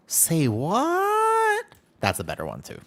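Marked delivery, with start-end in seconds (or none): 0.1-1.6 very high-pitched baffled squeak; mock confused squeal; high squeaky say what. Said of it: "sometimes you just go".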